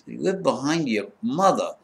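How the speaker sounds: quiet mock disbelief; deadpan disbelief. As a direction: mutter it back, incredulous. Baffled deadpan reply to absurd threat.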